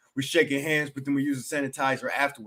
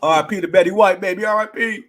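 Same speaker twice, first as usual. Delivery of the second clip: loud shout-out; 8 semitones higher, 7 dB louder than usual. Real, not acted. Sign-off shout-out.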